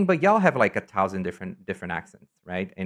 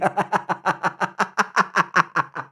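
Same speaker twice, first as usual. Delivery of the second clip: long high peal of laughter; helpless high laughter, slowly petering out; real laughing fit; 9 semitones higher than usual. Real, not acted. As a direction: burst out laughing and let it run on. Bursts out laughing at chat comment.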